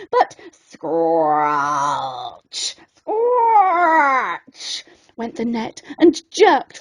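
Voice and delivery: drawn-out scratchy scrape, slow; scratchy sound effect. A narrator's reading. Narrator mimicking the net scraping the boat.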